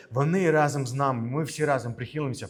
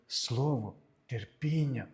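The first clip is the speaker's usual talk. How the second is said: слово терпіння very slow and softer; emphasis on терпіння; 5 dB quieter, much slower than usual. Acted. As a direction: draw out слово терпіння with quiet weight. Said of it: "І наголос якраз на це".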